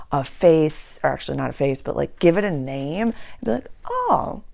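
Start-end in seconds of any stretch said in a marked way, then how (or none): 3.9-4.4 high yelped Oh of mock surprise; squeaky high surprised oh. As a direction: feign catching it sneaking up. Mock reaction to craving.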